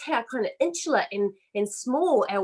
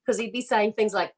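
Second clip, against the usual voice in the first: ordinary delivery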